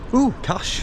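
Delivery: high, delighted mock "ooh" at the sight of cash; surprised shop assistant. Cashier amazed to be handed cash, mock delighted squeal. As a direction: imitate the cashier thrilled to see a banknote. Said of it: "the woman behind the counter was like".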